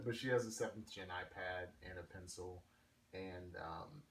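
ordinary delivery

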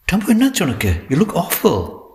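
high-pitched, dismayed question; shocked concern. A narrator's reading. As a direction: react in dismay, voice jumping up. Shocked at friend's haggard look. (அதிர்ச்சியும் கவலையும் கலந்த உயர்ந்த குரலில்)

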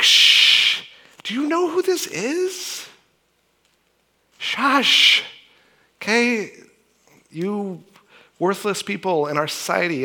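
hushed then high, scornful crowd voice; stern shushing. Retold or imitated. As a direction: shut the beggars up with contempt. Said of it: "the crowd sternly told them to be quiet".

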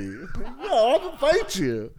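slow, laughing fake threat; laughing mock threat. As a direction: joke about fighting through laughter. Joking threat while laughing.

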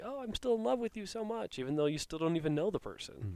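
high, dreamy lovestruck voice; smitten fan. Retold or imitated.

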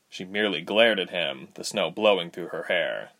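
ordinary delivery

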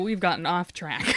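ordinary talk

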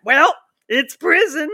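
high, loud mimicked retort; mock the dismissive critics loudly; mocking dismissal. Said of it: "And people are like".

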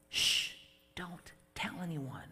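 very soft hushing; hushed. Pretend. Jesus hushes the healed man, very softly. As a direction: keep it secret, softly.

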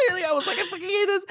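still laughing. Real, not acted.

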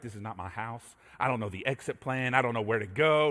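put-on frightened teen muttering in the dark; hushed panic; scared hush. Lost and scared in strange house.

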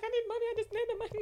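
high-pitched, loud money moan; complaining. Retold or imitated. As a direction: whine it up high as an acted complaint. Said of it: "I was complaining".

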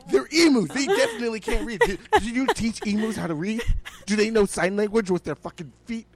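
loud, high, disbelieving outburst; can't believe what he's hearing; incredulous rant. Real, not acted. Podcaster ranting at hotel owner, loud and incredulous.

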